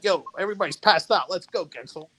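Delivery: excited quoted call to a friend; imitating a rallying call. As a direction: egg a buddy on to head out. Retelling friend rousing him to leave.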